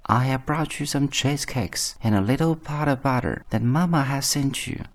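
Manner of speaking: wolf posing as a little granddaughter; imitated little girl. A narrator's reading. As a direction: lift the voice high like a child's. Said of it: "speaking like the little girl as well as he could".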